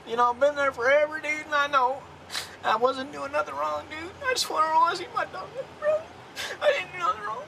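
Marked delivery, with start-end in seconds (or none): 4.3-7.4 higher, softer tearful pleading; crying. Real, not acted. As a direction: plead through tears. Detained man crying in car.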